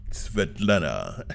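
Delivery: slow, low, sultry imitation of a woman; sexy woman's voice. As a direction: purr the name slowly and low, imitating her.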